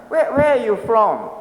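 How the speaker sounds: imitating a persistent questioner; insistent questioning. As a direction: press for the real answer. Asker rejects answer, asks again.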